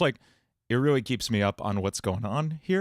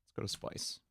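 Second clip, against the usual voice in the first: ordinary delivery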